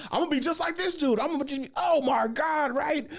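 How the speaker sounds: squeaky imitation of his own freak-out; panicky. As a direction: spiral into hammed-up dread. Panicking about following the bombing comic.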